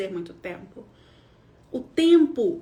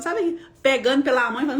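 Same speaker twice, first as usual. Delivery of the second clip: ordinary talk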